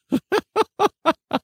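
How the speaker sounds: high giggle at the absurd number; burst of laughter. Real, not acted. Host laughing at tiny download counts.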